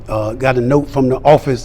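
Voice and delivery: ordinary delivery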